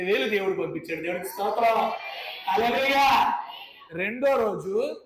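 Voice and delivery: preaching praise. Acted. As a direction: lift the congregation up. Preacher shouts hallelujah. (బోధకుడి స్తుతి స్వరం)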